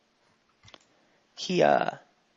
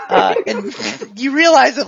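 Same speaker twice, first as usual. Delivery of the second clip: usual speaking voice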